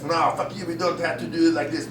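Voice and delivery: dismissive, dropping in pitch; French part of me. Acted. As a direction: brush off the nagging voice. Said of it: "the French part of me".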